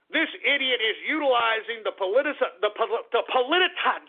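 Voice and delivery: stumbling over politicization, breaking it off as politici- and politi- again and again; tongue-tied stumble. Real, not acted. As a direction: trip over politicization in real frustration, then finally force the whole word out with a laugh. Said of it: "I'm stumbling, mumbling like a freaking jerk".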